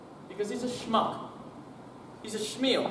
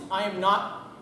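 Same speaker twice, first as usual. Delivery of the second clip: normal talking voice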